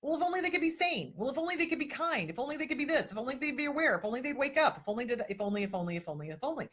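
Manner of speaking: pleading wishfully, winding down to a sigh; mock wishful pleading. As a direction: play the hopeful healer clinging to if only. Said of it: "We go".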